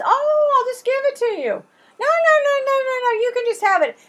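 high, hammed-up generous seller voice; mock generous seller. Haggling seller feigns generosity.

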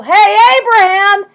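loud offhand call across a distance; casual shout. Pretend. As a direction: yell it like hailing a neighbour. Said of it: "he didn't just go".

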